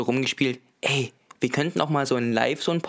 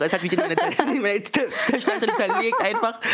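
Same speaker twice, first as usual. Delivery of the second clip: laughing melodrama; 6 semitones higher than usual. Pretend. Melodramatic joke about being burned.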